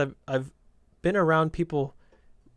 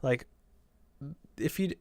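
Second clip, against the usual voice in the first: ordinary talk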